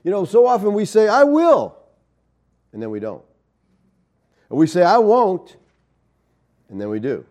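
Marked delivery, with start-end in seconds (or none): 1.1-1.8 loud, high-pitched resolve; emphatic vow. Acted. Preacher voicing believers' promises to themselves, emphatic vow. 4.8-5.6 loud, raised-pitch vow; emphatic refusal. Acted. Preacher voicing believers promising not to sin, emphatic refusal.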